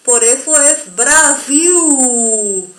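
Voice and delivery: slow: Brasil with the final L said as U; Portuguese Brasil. Shown as a demonstration. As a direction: say Brasil ending in a u sound, slowly. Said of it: "como nuestra letra U".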